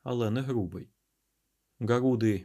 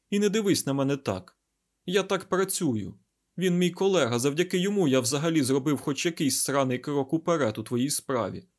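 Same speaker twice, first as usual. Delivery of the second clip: irritated scientist, raised voice; higher, insistent self-justification; Айзек; 4 semitones higher, 4 dB louder than usual. Acted. Scientist defending his colleague to the garuda, irritated.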